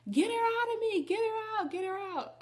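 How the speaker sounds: high, loud frantic cry, fading; retold labor scream. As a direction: shout like a mad woman in labor. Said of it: "kept screaming at her".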